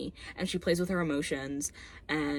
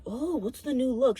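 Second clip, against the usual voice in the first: mock coach; 6 semitones higher than usual. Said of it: "the coach is like".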